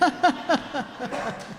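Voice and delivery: a short laugh that falls away; amused laugh. Real, not acted. Teacher laughing at the heart-shaped hole joke.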